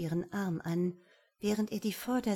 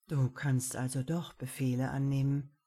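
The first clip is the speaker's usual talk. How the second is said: teasing male voice; 5 semitones lower than usual. Acted. Christopher teasing Anna.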